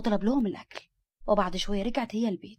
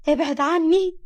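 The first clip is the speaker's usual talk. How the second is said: high, loud frightened cry; more frightened; 8 semitones higher, 8 dB louder, slower than usual. A narrator's reading. Nour recoils from the man approaching her, crying out afraid. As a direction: shout him away in fear. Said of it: "خافت منه أكتر وقالت".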